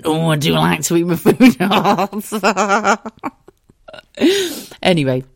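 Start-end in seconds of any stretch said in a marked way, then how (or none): 0.0-3.0 slow, louder, laughing comic posh lady; Little Britain character voice. Retold or imitated. Daughter mocking mum as a Little Britain character, slow and laughing. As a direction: ham up mum like a sketch show character. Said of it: "a character from Little Britain".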